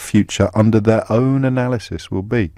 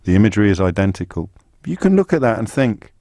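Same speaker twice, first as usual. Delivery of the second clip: usual speaking voice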